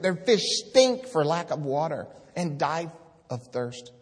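declaiming God's words from the pulpit; scripture proclaimed. Acted. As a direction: thunder the prophet's words to the congregation.

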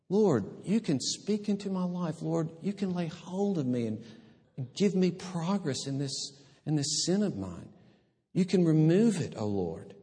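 a lightly voiced prayer, pleading under the breath. Preacher modelling a prayer to God, softly pleading. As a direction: pray it softly, asking for help with sin.

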